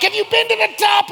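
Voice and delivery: high, loud, insistent cheery shout; real upbeat. Retold or imitated. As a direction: badger them with hammed-up enthusiasm. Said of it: "He was, like, real upbeat".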